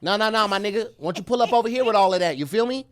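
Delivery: lightly voiced laid-back LA homie; LA accent. Host imitates how people in LA talk, in an LA accent. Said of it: "it's kinda nasally".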